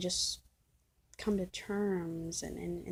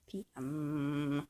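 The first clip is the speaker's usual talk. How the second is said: funny put-on P M; 4 semitones lower than usual. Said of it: "I don't know why I said it like that".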